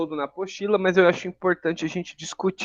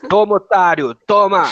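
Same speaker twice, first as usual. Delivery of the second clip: loud jeering taunt; 5 semitones higher, 12 dB louder than usual. Shown as a demonstration. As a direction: shout it out to tease.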